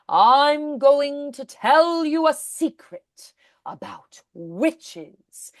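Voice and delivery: slow whisper with long vowels; stretched vowels, whispered. Shown as a demonstration. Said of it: "a little longer vowels".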